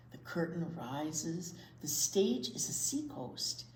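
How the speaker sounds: ordinary delivery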